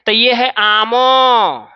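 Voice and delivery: "आमो" stretched slowly with an added final o; teacher showing the wrong vowel ending; drawn-out wrong reading. Shown as a demonstration. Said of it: "ऐसे गलती करते हैं हम लोग".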